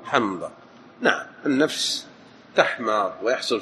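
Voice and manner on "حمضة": lower, softer حمضة in two syllables; confirming pronunciation. Shown as a demonstration. Teacher confirming to his reader, lower حمضة as ham-da. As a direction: say حمضة as ham-da, no vowel after م. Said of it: "أو حمضة".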